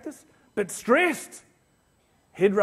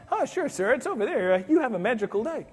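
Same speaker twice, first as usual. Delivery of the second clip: cheery Disney staff; 4 semitones higher than usual. Retold or imitated.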